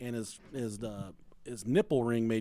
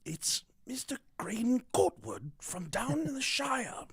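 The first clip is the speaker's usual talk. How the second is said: whispered butler announcement; 10 semitones higher than usual. Pretend.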